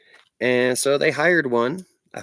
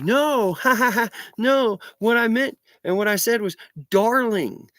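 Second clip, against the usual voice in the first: higher, giggly mock of a flustered clerk; nervous laughing clerk; 8 semitones higher than usual. Clerk nervously laughing off her slip, in a higher voice. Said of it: "And she's like".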